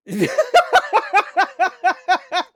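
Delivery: high real burst of laughter; laughing at a made-up word; loud laugh. Real, not acted. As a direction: crack up out loud.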